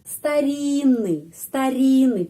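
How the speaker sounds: старинный slowly, the double Н as one N; single N. Shown as a demonstration. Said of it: "mengucapkan seperti satu huruf". (старинный pelan, НН seperti satu N)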